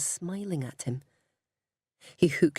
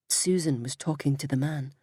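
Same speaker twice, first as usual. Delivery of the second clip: usual speaking voice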